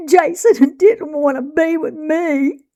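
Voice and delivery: high sobbing voice; crying. A narrator's reading. Girl crying to Mama about her brother, sobbing. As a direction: sob out the words.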